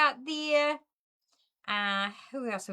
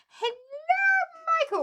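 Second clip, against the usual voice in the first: high, drawn-out, louder hello; sing-song hello; 6 dB louder than usual. Real, not acted. Host welcoming viewer Michael with a high sing-song hello.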